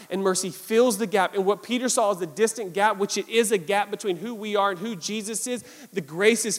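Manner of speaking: usual speaking voice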